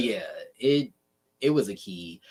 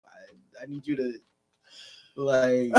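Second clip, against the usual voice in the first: laughing; 3 semitones higher, 6 dB louder than usual. Retold or imitated. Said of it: "I'm like".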